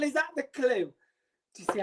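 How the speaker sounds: ordinary delivery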